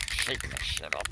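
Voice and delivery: a deep, voiced repeat of the joke; low voiced. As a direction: say "Shaking the shit up" low and aloud, in a lightly put-on voice. Same words said aloud, low.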